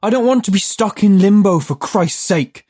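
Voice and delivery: high, loud indignant yell. A narrator's reading. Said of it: "Judd yelled".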